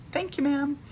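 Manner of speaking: high, light, mock-sweet thanks; put-on politeness to a rude clerk; retold polite thanks. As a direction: pitch it up, courteous on the surface.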